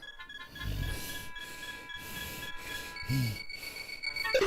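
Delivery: breathy laugh. Real, not acted. Laughs at eavesdropping joke.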